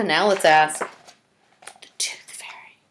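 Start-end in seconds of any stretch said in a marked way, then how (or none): 1.8-2.8 soft unvoiced whisper; whispered name. Reader whispers who comes next. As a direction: whisper it like magic.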